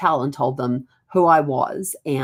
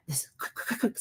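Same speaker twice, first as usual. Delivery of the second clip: urgent whisper; 6 semitones higher, 11 dB quieter than usual. Retold or imitated. Sneaking in quickly.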